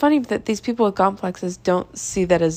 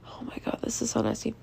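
whispered, much softer, grossed out; disgusted whisper; 3 semitones higher, 12 dB quieter, faster than usual. Real, not acted. Whispering disgust about what the coworker asked. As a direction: hush it like it's too gross to say aloud. Recoiling from the memory.

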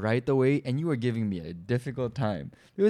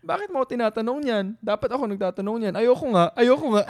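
teasing refusal; 8 semitones higher, much faster than usual. Retold or imitated. Fiancé teasing her during the proposal, fast and playful refusal.

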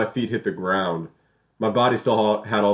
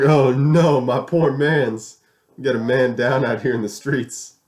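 mock dramatic alarm; 6 semitones higher, 5 dB louder, slower than usual. Mock alarm over fallen friend.

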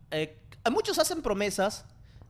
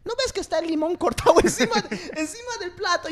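mock waitress, laughing; 10 semitones higher, 4 dB louder than usual. Waitress scolding in retold story.

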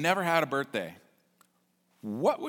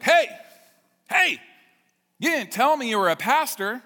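much higher, louder startled hey; surprised call-out; 10 semitones higher, 7 dB louder than usual. Retold or imitated. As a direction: call across the room in disbelief. Owner calling out, surprised.

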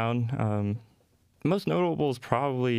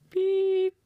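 high, drawn-out machine beep; 3 dB louder than usual. Retold or imitated. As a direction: imitate a slow card reader beeping. Imitating slow card machine.

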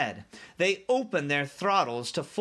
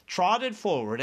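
plain reading voice

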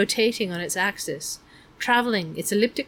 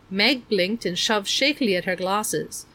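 plain reading voice

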